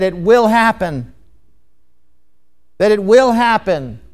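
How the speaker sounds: WILL leaned on, firm falling close; stress on WILL. Shown as a demonstration. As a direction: hit "will" as a settled certainty. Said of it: "the emphasis for "shall" means".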